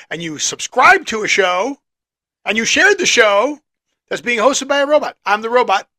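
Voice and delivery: loud upbeat host intro; announcer intro. Acted. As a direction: pitch the show like a host. Show intro read.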